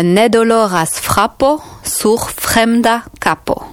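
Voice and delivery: "Ne doloras frapo sur fremda capo" slowed, word by word; slow repeat. Shown as a demonstration. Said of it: "Donc, ne doloras".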